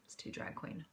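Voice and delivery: breathy whispered aside to herself. Real, not acted. Talking to vlog viewers, whispered self-mocking aside. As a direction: whisper it off as a throwaway joke.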